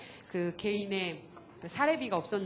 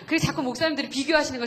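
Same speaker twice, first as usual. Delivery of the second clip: ordinary delivery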